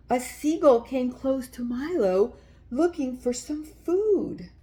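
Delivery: put-on gentle read-aloud for little kids; children's story reading. A narrator's reading. Reading a picture book aloud.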